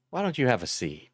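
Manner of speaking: lightly voiced Chris Hansen asking him to sit; hint of a calm TV interrogator; Chris Hansen impression. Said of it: "Chris Hansen impersonator".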